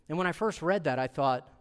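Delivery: usual speaking voice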